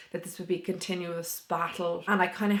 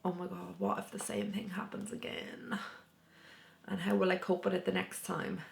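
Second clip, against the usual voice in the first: whispered, anxious inner worry; hushed fearful thought; scared whisper; 7 dB quieter than usual. Retold or imitated. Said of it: "I actually felt ... like".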